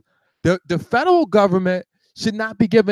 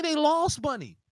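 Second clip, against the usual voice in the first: normal talking voice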